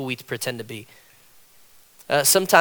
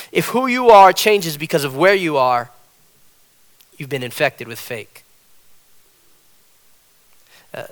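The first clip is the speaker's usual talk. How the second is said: usual speaking voice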